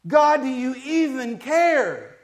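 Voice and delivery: loud, slow cry to heaven; yelling out to God. Acted. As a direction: yell it up to God, stretching the words. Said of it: "he's yelling out".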